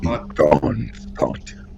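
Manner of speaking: low whispered demon hiss. Pretend. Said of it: "doing the fucking demon voice".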